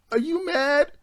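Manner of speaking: put-on high, sarcastic girl voice, well above his own; mocking girl voice. Retelling the movie to friends, imitating the woman in a high sarcastic voice. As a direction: put on a high voice and sneer the answer. Retold movie quote, girl's voice.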